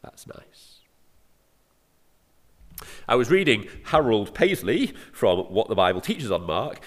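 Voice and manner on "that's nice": near-whispered appreciative murmur; hushed aside. Real, not acted. Preacher savours his own phrase aside, hushed. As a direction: drop right down to a whisper, savouring.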